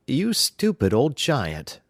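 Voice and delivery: a nagging giantess; scolding giantess. Acted. Giantess scolds her husband with a touch of mock scorn. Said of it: "said his wife".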